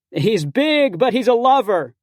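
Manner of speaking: high, loud shout; bellowing. Acted. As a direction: call it across the lawn. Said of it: "tattooed god guy bellows".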